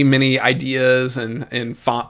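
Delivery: normal talking voice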